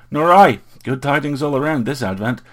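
high, loud, breezy cheer; lively and clear. A narrator's reading. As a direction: ring it out in high spirits. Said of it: "His voice was as lively and clear".

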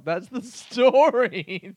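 slow, breaking into a laugh; laughing through the words. Real, not acted.

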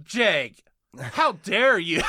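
drawn-out mock indignation; mock outrage. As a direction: act scandalised, slowly and playfully. Joking offence at co-host's nitpick.

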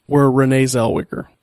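normal talking voice

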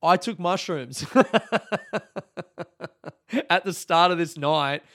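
cracking up mid-sentence at yourself; laughing. Real, not acted. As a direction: laugh out the realization. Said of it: "the next thing I realized that made me laugh".

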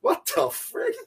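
squeaky, sky-high disbelief; a squeal of mock shock. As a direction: boss reacting to the prank call in stunned disbelief. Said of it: "And I go".

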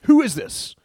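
high, loud outraged king, slowing at the end; king's outraged demand. Retold or imitated. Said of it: "he spoke up and said".

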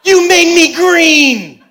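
put-on very high, loud, slow toddler outcry; little girl shrieking at a grass stain; toddler wail. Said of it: "looks at the grass and goes".

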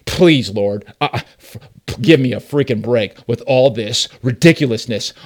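loud exasperated sarcastic rant at God; mock-outraged arrogant prayer. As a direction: scoff at the Lord like he's lost it. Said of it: "a very sarcastic and, and an arrogant tone".

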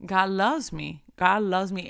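normal talking voice

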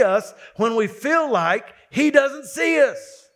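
high, forceful sermon delivery; impassioned preaching. Real, not acted. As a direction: preach it hard, punching the key words. Preacher building to forceful exhortation.

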